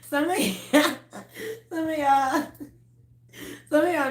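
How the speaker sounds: high-pitched, breaking into laughter; can barely get it out for laughing. Real, not acted. Laughing fit mid-sentence.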